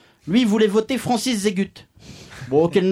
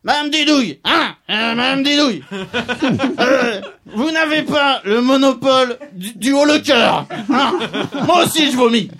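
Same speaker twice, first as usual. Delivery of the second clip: put-on loud, higher, laughing drunk voice; drunk debater; 5 semitones higher, 4 dB louder than usual. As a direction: ham up a tipsy debate jab. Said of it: "Fallait entendre Madame Starlet".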